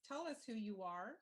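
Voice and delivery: ordinary talk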